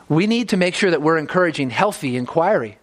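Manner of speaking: normal talking voice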